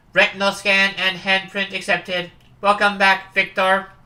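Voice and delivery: loud, flat robotic system warning; security computer. Acted. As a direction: bark it like an automated alarm. Security computer greets Victor.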